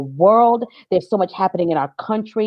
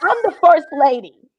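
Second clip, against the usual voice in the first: higher, mock-grand "first lady"; grand self-announcement; 7 semitones higher than usual. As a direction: announce yourself like church royalty. Plays up her church status.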